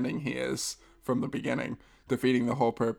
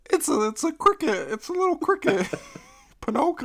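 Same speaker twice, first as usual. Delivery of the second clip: much higher, squeaky cartoon cricket; 13 semitones higher than usual. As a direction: do Jiminy like Mickey Mouse swallowed a toy. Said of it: "That's what the accent was".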